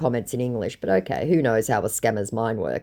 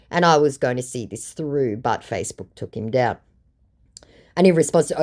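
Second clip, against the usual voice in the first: ordinary talk, nothing marked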